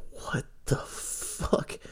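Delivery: slow, breathy whispered disbelief; stunned whisper. Retold or imitated. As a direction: whisper it slowly, too shocked to speak up. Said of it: "I'm like".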